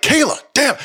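high-pitched, exasperated dad outburst; mock fed-up yelp at your kid; exasperated yell.

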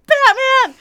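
put-on excited child's battle cry; kid yelling Batman. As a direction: shout it like a kid playing superheroes. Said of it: "these kids are, like".

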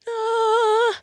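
high, sustained heavenly "ahh"; awestruck "ah". Retold or imitated. Player finding the perfect weapon, awestruck sigh. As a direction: hold a high note of mock revelation.

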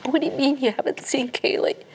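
retold scream. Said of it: "I said".

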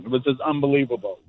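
ordinary talk

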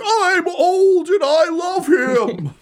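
high, drawn-out holler; cheering fan. Acted. Old Standis clapping for Reginald, adoring holler. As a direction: cheer him on at the top of your range. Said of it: "Standis is up, clapping, being like".

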